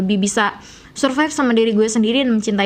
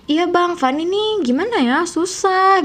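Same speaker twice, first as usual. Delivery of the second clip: high, slow, sweetly complaining; soft high chat, slowed down; soft Sundanese chat; 7 semitones higher, slower than usual. Shown as a demonstration. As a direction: whine gently and slowly. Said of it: "kalau ngobrol juga".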